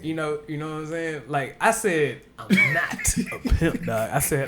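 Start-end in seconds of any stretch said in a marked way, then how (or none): 2.4-4.1 laughing. Retold or imitated. Retold own reply, laughing.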